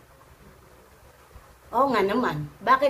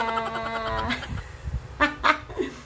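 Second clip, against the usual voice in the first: giggle; 4 dB quieter than usual. Real, not acted. Laughs at her own example.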